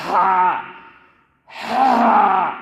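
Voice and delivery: loud, forceful "ہا" pushed from the middle of the throat; mid-throat big ha. Shown as a demonstration. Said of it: "اس میں بھی بیچ میں سے نکالنے کی۔".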